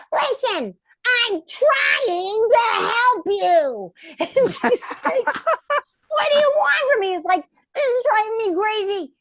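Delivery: high, shrieking frantic puppet voice; Ginger's rant. Acted. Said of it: "finally Ginger goes".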